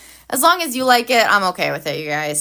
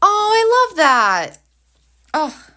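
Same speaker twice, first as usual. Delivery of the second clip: drawn-out, high and louder gush of delight; 9 semitones higher, 6 dB louder, slower than usual. Real, not acted. Reacting to a viewer's comment, slow delighted gush. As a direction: swoon over it, slow and loud.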